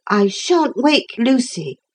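lightly voiced boy, high and slow; boy character. A narrator's reading. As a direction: lift the pitch into a child's voice.

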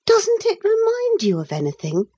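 put-on high-pitched little girl voice; high child's voice, questioning; girl character's question. A narrator's reading. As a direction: ask it as a young girl, very high. Girl questioning boy in a story.

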